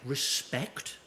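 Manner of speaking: ordinary delivery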